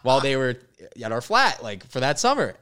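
loud, high, laughing disbelief; retelling the boat photo with rising excitement; excited discovery. Retold or imitated.

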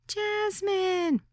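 put-on high, breathless little-girl voice; breathless young girl. A narrator's reading. Hostess greeting the guest at the door, high and breathless. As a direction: say the name in one high breathless rush. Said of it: "she said breathlessly".

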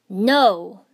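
loud, raw cry of no; shout. A narrator's reading. Naruto shouting as Yukie sacrifices herself, loud raw cry. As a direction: belt it out in panic. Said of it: "Naruto shouted".

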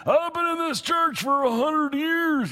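mock someone bragging about their seniority; mock old-timer. Mocking a self-important church member.

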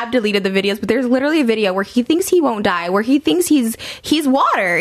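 ordinary talk

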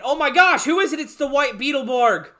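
high, loud announcer-like gush; mock-excited reveal. Host revealing the mystery hero's arrival, mock-thrilled shout. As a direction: build it up as a huge surprise.

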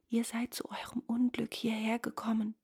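soft, breath-filled secretive warning; hushed warning. A narrator's reading. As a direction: warn him under your breath. Frightened wife warns the youth.

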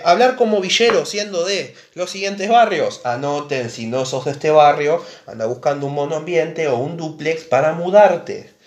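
ordinary talk

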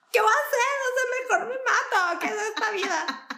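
hammed-up childish anguish, laughing; mock despair. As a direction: ham up a child's tragedy. Mock childhood despair over folders.

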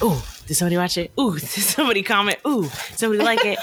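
eager excitement. Retold or imitated. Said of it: "I was like".

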